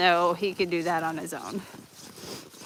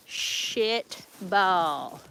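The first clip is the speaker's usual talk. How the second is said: SHIT stretched long, then BALL; "Shit ... ball", each word lengthened; drawn out. Real, not acted. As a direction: slowly draw out "Shit ball". Same words repeated, stretched out.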